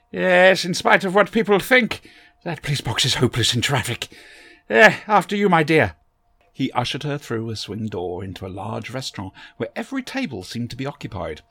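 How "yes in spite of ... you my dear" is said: put-on higher and louder, fussy old gentleman; eccentric Doctor voice. A narrator's reading. The Doctor greets Barbara from behind, a higher, louder fussy old gentleman. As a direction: bustle in as a fussy, kindly eccentric.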